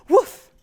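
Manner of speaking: loud, high dog bark imitation; shrieked woof. Little bird trying out a song for spring, shrieked woof.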